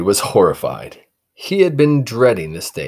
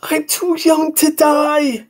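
hammed-up scream; 5 dB louder, slower than usual. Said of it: "he screamed inside his head".

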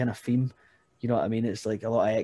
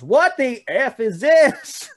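hillbilly heckler; 13 semitones higher, 9 dB louder, much slower than usual. Retold or imitated. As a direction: bellow it like a baffled fan in a trucker hat. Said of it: "and he just goes".

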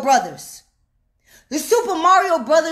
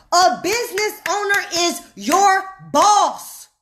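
"A business owner is your boss" slowed, with separated words; emphatic repetition; 2 semitones higher than usual. Shown as a demonstration. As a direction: set "your" and "boss" apart with pauses. Repeats the point word by word.

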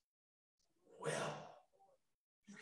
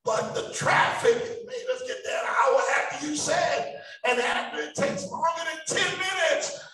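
put-on loud, higher, impatient kid in the back seat; playing a five-year-old; 5 semitones higher, 9 dB louder than usual. Child in the back seat complaining to parents, mock whine. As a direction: act out the fidgety child on a long trip. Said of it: "I'm a five-year-old kid".

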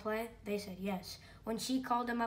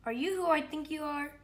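put-on higher, louder hopeful boy voice; cat voice; 6 semitones higher, 5 dB louder than usual. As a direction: ask in wonder.

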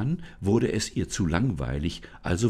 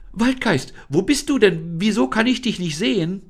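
put-on high, louder girl's voice calling out in relief; relieved call; 12 semitones higher, 6 dB louder than usual. Freed Lisa calls to the unseen spirit, high and relieved. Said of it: "Erleichtert rief sie".

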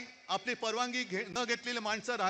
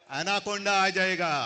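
slow, louder menacing film line; film villain line; 2 semitones lower, 6 dB louder, much slower than usual. Retold or imitated. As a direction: draw it out like a movie villain. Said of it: "शोलेमध्ये एक dialogue आहे". (सावकाश, जोरात चित्रपटातील खलनायकी संवाद)

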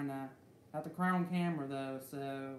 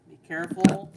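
loud cautionary outburst; sudden loud warning; 3 semitones higher, 15 dB louder than usual. Real, not acted. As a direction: blurt it out loud. Warning viewers about the alternator.

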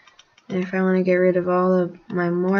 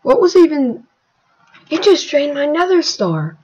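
higher, louder shocked complaint; startled disbelief; 7 semitones higher, 8 dB louder than usual. Real, not acted. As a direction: react in loud disbelief at a sudden loss. Star drained unexpectedly in game.

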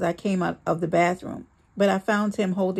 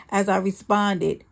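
ordinary delivery

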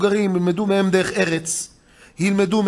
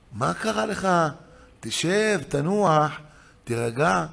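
softer, sinking calming voice; soothing wife; 3 semitones lower, 4 dB quieter, slower than usual. Retold or imitated. Wife calms husband upset after a quarrel, soothing. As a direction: settle him down, getting lower and softer.

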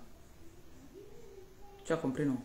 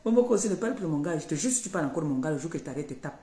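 fast angry warning; much faster than usual. Real, not acted. Threatens rival about her man.

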